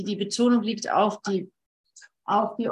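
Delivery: ordinary talk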